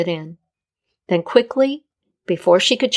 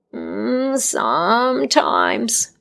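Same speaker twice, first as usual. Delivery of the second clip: cryptic Death; 2 semitones higher, much slower than usual. A narrator's reading. Death answering the healer cryptically, slow. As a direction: hold back the truth.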